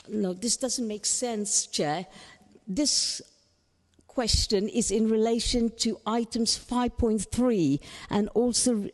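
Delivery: usual speaking voice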